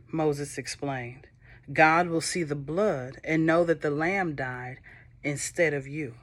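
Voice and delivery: ordinary delivery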